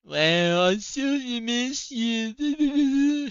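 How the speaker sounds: high, drawn-out mock pleading voice; mocking whine. Mocking the harasser's text, drawn-out high whine. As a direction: stretch it out like a pathetic lovesick plea.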